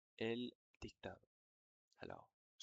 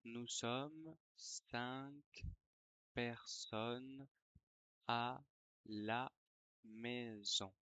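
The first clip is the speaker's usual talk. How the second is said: dictating. Shown as a demonstration. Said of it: "je vais dicter une dictée".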